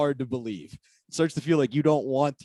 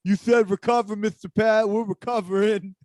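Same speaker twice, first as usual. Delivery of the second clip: mock dumb excuse; 9 semitones higher, 4 dB louder than usual. Mocking lazy workers' excuse.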